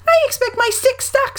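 put-on very high squeaky child voice; Roo. A narrator's reading. Roo worries his stick is stuck, high squeak. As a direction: chirp it in a tiny high voice. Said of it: "said Roo".

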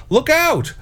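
high-pitched cry of warning; sudden high storybook alarm; yelled warning. A narrator's reading. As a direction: yell it high, without much volume. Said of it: "could yell".

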